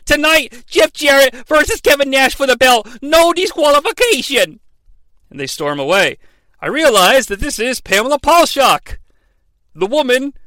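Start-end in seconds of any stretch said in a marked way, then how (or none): 0.1-4.6 much higher, louder, brash promo yell; Vince Russo. Retold or imitated. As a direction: bark it out like a pushy boss. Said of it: "Vince Russo says".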